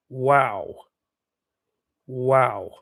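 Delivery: ordinary talk, nothing marked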